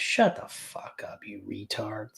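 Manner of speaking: hushed, voiceless jab; whispered insult. Real, not acted. Streamer whispering insults at YouTube traders. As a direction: whisper it under your breath.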